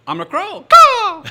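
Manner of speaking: high, loud crow screech; crow impression. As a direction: shout it like a crow. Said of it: "He goes".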